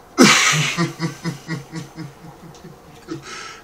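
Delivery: high, loud laugh that runs on; long loud laugh. Real, not acted. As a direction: burst out laughing at length. Laughs at being called genius.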